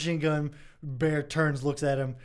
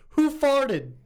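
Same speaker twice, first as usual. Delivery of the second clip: put-on higher, louder childish punchline; kid's punchline; 11 semitones higher, 4 dB louder than usual. Kid to mom, higher and louder childish answer. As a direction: pop it out like a proud kid. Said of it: "The little kid says".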